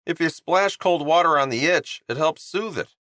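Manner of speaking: chirpy guy pitching his skincare line; cheerful Honeybun. Acted. Honeybun speaking.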